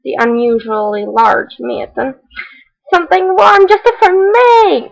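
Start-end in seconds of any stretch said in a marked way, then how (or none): 2.9-4.9 high, whimpering little voice; a needy hedgehog half crying; whiny hedgehog. Acted. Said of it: "He was not considerate".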